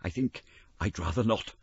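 stammering, timid refusal; nervous reluctant refusal; reluctant Scrooge. Acted. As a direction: back away hesitantly. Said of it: "said Scrooge".

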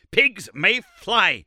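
mock him with slow fury; furious sneer. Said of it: "cried Summerlee furiously".

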